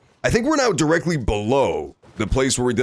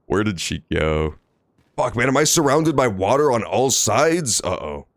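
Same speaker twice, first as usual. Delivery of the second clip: ordinary talk, nothing marked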